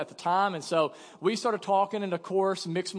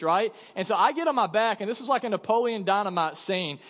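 normal talking voice

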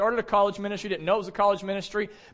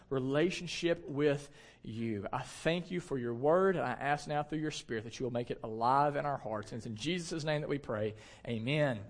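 softer, lower, earnest prayer voice; 5 semitones lower, 6 dB quieter than usual. Real, not acted. Pastor praying over his congregation, soft and hushed. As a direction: pray quietly, with hushed sincerity.

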